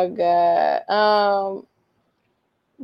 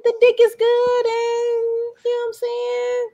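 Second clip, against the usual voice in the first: pitched up and drawn out, teasing; high teasing voice; 13 semitones higher, slower than usual. Real, not acted. Admitting to her friend why she goes back, in a high teasing voice. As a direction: sing it high like a confession.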